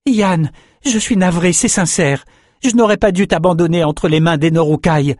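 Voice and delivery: high, earnest apology; pleading young fighter. A narrator's reading. Swordsman regrets abandoning his friend, earnest pleading.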